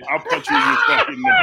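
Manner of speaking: high shouted mock threat; rowdy party animal. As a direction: scream it rowdy and wild.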